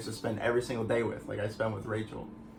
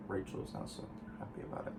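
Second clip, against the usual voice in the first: very soft, near-whispered admission; hushed aside; 3 semitones lower, 11 dB quieter than usual. Real, not acted. Boyfriend admitting his girlfriend is unhappy, hushed aside. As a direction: drop to an under-the-breath murmur.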